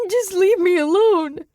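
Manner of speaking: very high, loud shriek; desperate shrieking. A narrator's reading. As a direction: shriek it as you turn away. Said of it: "he shrieked".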